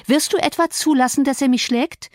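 high-pitched, indignant plea; alarmed appeal. Acted. Cathy asks Harrington to protect her, alarmed.

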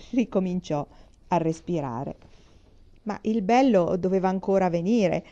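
ordinary delivery

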